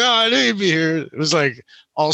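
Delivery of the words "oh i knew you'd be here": much higher, sly knowing voice; playful imitation of a friend; friend's knowing reply. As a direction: toss it off high and teasing.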